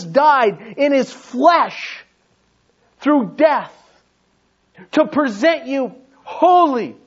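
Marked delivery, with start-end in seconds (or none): none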